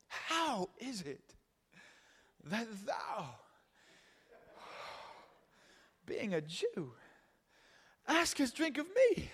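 hushed, slow, swooning reverence with swooping pitch; awestruck, honored woman. Acted. Preacher mimicking his old reading of the Samaritan woman, awestruck. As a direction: gush in drawn-out, breathless mock rapture. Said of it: "read this verse a little like this".

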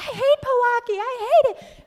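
very high, loud mock tantrum; shrieked complaint, played for laughs; retold angry outburst. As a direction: wail it in hammed-up fury. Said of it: "And I'd be angry".

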